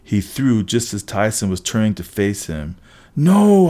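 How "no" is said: loud, high, drawn-out cry; desperate yell. A narrator's reading. Hero seeing friend targeted by the ball, loud yell. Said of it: "I yelled".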